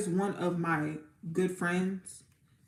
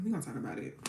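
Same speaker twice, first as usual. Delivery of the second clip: same words again, dropped much softer; softer repeat; 4 semitones lower, 8 dB quieter, much faster than usual. Real, not acted. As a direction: echo it quietly to yourself.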